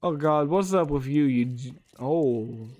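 ordinary talk

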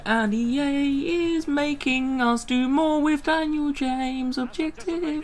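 high, slow mock lament; drawn-out whiny complaint, high-pitched; mock wailing. Gamer moaning about the worst objective, mock wailing.

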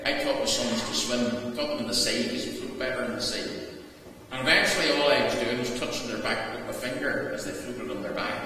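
usual speaking voice

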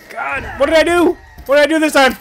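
high, loud panicked cry under attack; freaking out as she stabs him; panicked yelp. Real, not acted. Attacked by zombie in game.

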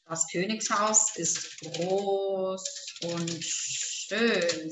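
low, slow, drawn-out dictation. Shown as a demonstration. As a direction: stretch each word for someone writing it down. Dictating the sentence while writing.

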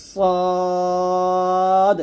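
long held "সদ" with the mouth filled; thick, full-mouthed. Shown as a demonstration. Teacher demonstrating a heavy letter, very long full-mouthed "সদ". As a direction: fill the mouth and stretch out "সদ". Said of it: "মুখটা ভরে উচ্চারণ করতে হবে".

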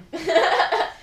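loud, creepy witch cackle; evil cackle. Pretend. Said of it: "Do your best evil laugh".

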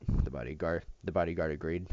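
plain reading voice

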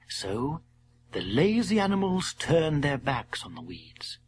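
plain reading voice

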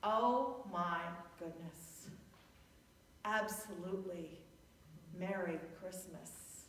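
dazed and quiet. Retold or imitated. Pastor answering the pharmacy clerk's greeting, dazed and soft. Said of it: "like a deer in the headlight".

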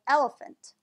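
"Elephant" with second and third vowels as uh; schwa in elephant. Shown as a demonstration. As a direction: say "Elephant" as EL-uh-fuhnt. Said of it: "try pronouncing it as a schwa".

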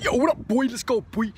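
high-pitched hammed-up gangster hype; put-on gangster voice, pitched well up. Vlogger showing how he imitates a gangster, hammed-up and high. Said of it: "like, gangster".

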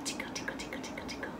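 soft unvoiced tickle whisper. Real, not acted. Teacher tickling a little one, whispering. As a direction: breathe it like a playful secret.

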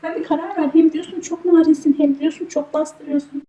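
exasperated high complaint. Real, not acted. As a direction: throw his words back at him. Wife complaining at husband.

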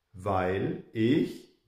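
ordinary talk, nothing marked

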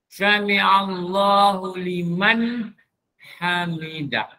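Sami Allahu liman hamidah drawn out; slow recitation. Shown as a demonstration. Ustaz demonstrating tajwid, slow chant. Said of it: "Hamidah tu, ha bulat tu".

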